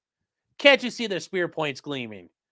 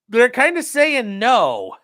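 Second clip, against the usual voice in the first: higher, louder, slow, weatherman style; mock forecaster; 7 semitones higher, 8 dB louder, much slower than usual. Streamer reading the eclipse forecast as a hammed-up weatherman.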